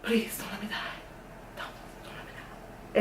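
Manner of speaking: breathy whispered plea to God; hushed begging prayer, barely voiced. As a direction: whisper it like a last desperate prayer. Desperate prayer retold in story.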